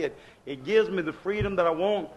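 ordinary talk

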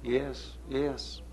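soft, spaced-out affirmations; hushed, steady reassurance of the point; quiet insistence. Real, not acted. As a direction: answer the objection softly but surely. Answers the imagined objector's no.

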